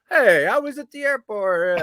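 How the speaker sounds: high, loud, drawn-out New York whine about the airport; New York accent. Acted. Host mocks JoJo complaining at the airport, hammed-up New York accent. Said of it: "New York accent".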